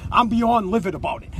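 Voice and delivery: usual speaking voice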